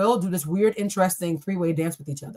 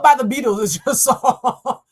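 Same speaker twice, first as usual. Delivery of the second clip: laughing; 3 semitones higher, 6 dB louder, slower than usual. Real, not acted. Laughing at the random card.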